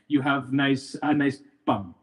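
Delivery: ordinary delivery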